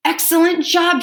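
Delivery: high, loud praise for the pupils; cheering teacher. Acted. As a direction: cheer the kids on like their teacher.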